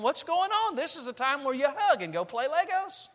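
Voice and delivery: can't make sense of the tears; father baffled when his daughter starts crying again; baffled dad. Retold or imitated. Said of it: "I'm thinking".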